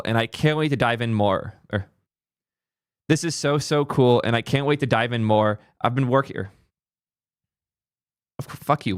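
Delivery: ordinary talk, nothing marked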